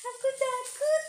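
high trembling scared voice. Acted. Mother acting a frightened child for her son. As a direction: quaver as if shaking with fear.

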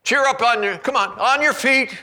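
high, loud call across the crowd. Acted. Disciples calling to the blind man, loud. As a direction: call him over. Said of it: "So they called to the blind man".

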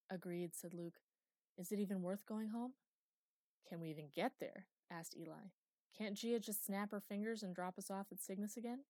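usual speaking voice

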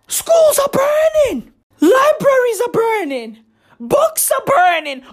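mock desperate wail for help; panicked wail. Crying out in panic.

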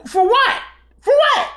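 incredulous retort. Retold or imitated. Challenging the aunt's threat, very high and incredulous. Retelling his own retort.

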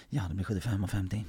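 low, soft cashier voice. Acted. Cashier at the window to customer, low muted voice. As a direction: lightly mimic an unfazed till worker.

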